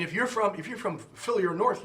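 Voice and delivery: ordinary talk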